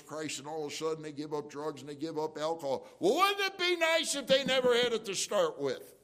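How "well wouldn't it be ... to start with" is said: higher and louder impassioned preaching; on the soapbox. Real, not acted. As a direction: plead with the congregation from the pulpit. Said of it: "I don't get on my soapbox now".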